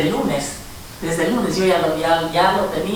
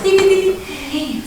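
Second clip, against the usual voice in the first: radar beeps; 11 semitones higher, slower than usual. Retold or imitated. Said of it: "o sus radares".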